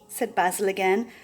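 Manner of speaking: ordinary delivery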